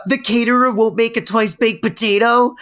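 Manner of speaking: big gasp, then high aghast question; mock horror. [name] reacting to terrible news, hammed-up horror.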